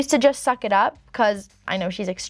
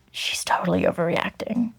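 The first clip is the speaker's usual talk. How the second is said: whispered aside, lower; mock whisper; 5 semitones lower than usual. Whispering the boyfriend's line to viewers, mocking. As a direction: hush it like a snide secret.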